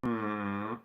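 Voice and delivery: ordinary talk, nothing marked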